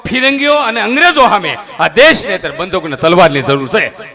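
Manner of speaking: rousing patriotic oration. Acted. Comedian praising young Sardar to the crowd, rousing speech. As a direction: stir the crowd with patriotic fire.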